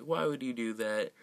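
normal talking voice

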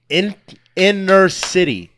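normal talking voice

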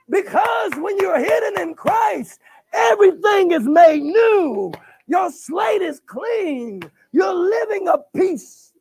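slow, shouted preaching climax; preaching at full force. Acted. Pastor preaching to the congregation, slow full-force shout. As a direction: drive each phrase home slowly, at full voice.

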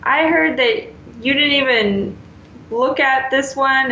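lightly mock the upset parent; complaining parent. Parent confronting the teacher, mildly mocked indignation.

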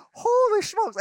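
high yelp of sticker shock; shocked exclamation. Retold or imitated. Husband reacting to resort prices, high shocked yelp. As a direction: squeal at the restaurant bill. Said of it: "I'm just like".

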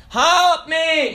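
imitated child's call, high; mock plea to be picked up; pleading child. Daughter reaching up to her mother, imitated high plea.

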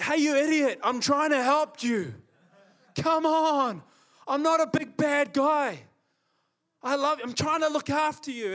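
high, loud pleading shout at the bird; exasperated shout. Retold or imitated. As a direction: yell at it that you mean no harm.

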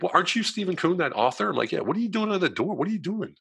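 high-pitched astonished questioning; incredulous reporter. Retold or imitated. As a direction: imitate someone amazed to find him there. Newspaper person reacting in surprise.

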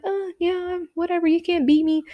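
put-on high, cocky kid voice; lightly mocking, dismissive boyish brag; competitive little boy. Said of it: "little competitive boys are like".

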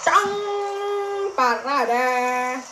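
Parade in a mock Indian accent, rolled r, Barara; drawn-out Parade like a put-on Indian accent. Streamer jokes to chat in mock Indian accent. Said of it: "Indischer Akzent, nicht Chinesisch".

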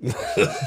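squeaky burst of laughter; high giggle. Real, not acted. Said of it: "I'm busting up".

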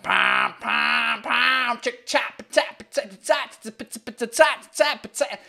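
vocal imitation of a percussive jingle; beatbox the intro music with punchy syllables; mouthed jingle. Shown as a demonstration. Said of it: "do the jingle myself".

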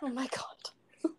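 breathless, slow, soft whisper of disbelief; whispered shock. Real, not acted. Listener stunned by the date story, a slow whisper. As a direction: gasp it out, stunned.

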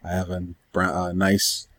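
normal talking voice

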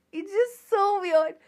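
high, slow, giggling disbelief; laughing. Real, not acted. To listeners, laughing at the virgin voice.

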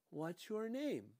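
lightly voiced bossy mother. Dika asks the cop.

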